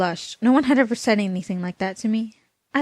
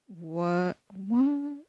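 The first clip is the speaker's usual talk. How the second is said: baffled; 6 dB quieter, much slower than usual. Real, not acted. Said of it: "reader reacts to the text".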